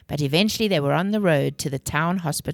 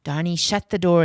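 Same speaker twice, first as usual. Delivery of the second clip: usual speaking voice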